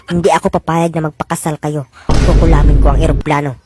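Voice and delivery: put-on old witch threatens to hex the couple's airplane, low menacing voice. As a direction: growl out the curse like a sorceress. Witch vows to curse plane.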